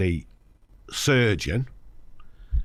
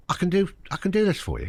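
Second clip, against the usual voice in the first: higher and fast, eager reassurance; surgeon's voice; 9 semitones higher, much faster than usual. Retold or imitated. Surgeon promising the patient he can fix it, higher and fast. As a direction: rush the promise out, pitched up.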